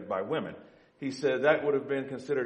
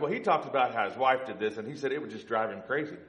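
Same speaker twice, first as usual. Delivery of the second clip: normal talking voice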